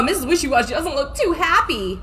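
plain reading voice

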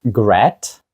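Gret with short e, as a question; great mispronounced "gret". Shown as a demonstration. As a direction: guess it wrongly as Gret. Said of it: "Ні, це great". (Gret з коротким «е», запитально)

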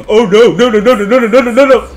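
backing away in panic; frantic denial; panicked no's. Real, not acted. Ghost approaching him.

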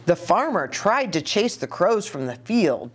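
usual speaking voice

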